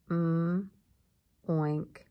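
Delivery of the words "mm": hummed "Mm", held loud; m sound. Shown as a demonstration. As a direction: hum "Mm" with lips closed. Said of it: "An M says".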